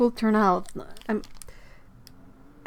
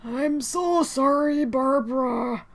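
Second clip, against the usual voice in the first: sympathetic; 6 semitones higher, 4 dB louder, slower than usual. Real, not acted. Sympathizing with a viewer's bad news.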